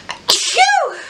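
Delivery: loud high achoo sneeze; sneezing. Real, not acted. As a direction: let a big sneeze out. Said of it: "I usually do the achoo noise".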